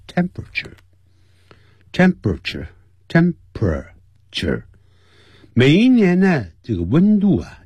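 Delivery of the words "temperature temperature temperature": "temperature" as three syllables, tem-pra-ture. Shown as a demonstration. Teacher showing the reduced three-syllable "temperature". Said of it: "变成三个音节".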